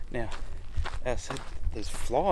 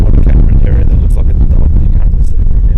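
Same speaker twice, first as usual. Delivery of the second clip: shouting over wind; 2 semitones higher, 20 dB louder, faster than usual. Real, not acted. Still outdoors in strong wind.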